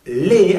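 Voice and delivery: emphatic "lé" for the plural "Le"; E sound. Shown as a demonstration. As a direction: give "Le" a clear E vowel. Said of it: "aquí sí es sonido E".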